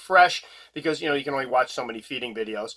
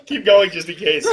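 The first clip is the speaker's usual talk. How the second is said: talking through laughter; giggling through the words; laughing; 13 semitones higher, 7 dB louder, slower than usual. Real, not acted. Laughing at spider footage.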